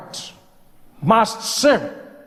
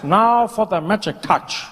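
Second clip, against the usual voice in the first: ordinary talk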